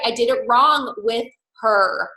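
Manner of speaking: normal talking voice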